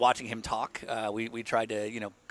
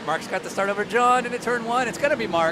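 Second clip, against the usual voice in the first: higher, louder play-by-play shout; excited race call; 10 semitones higher, 7 dB louder than usual. Real, not acted. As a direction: call the start like live commentary. Live race commentary call.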